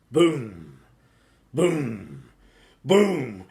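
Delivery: loud, falling booms; booming footsteps. A narrator's reading. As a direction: stomp each boom out. Giant footsteps shaking the room.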